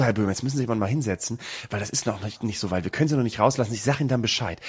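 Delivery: low, hushed train manager aside; imitating a secretive official; conspiratorial. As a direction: confide it softly, as if nobody else should hear. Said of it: "so ganz verschwörerisch".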